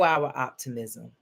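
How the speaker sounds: low, measured prayer voice; closing prayer. Acted. Minister praying over listeners, low and measured.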